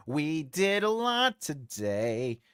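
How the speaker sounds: slow, stretched-out delivery; drawn-out repeat. Pretend. Streamer musing to the chat about the day, drawn out. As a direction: draw each word out, lingering on the last.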